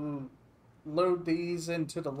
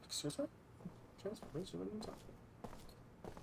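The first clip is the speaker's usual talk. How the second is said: puzzled aside; 3 semitones higher, 15 dB quieter, faster than usual. Pretend. Confused reply to announcement.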